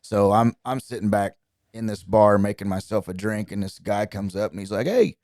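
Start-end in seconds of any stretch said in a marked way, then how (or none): 4.9-5.2 high, loud imitation Australian accent. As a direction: put on your best hearty Australian accent. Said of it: "that's my best Australian accent".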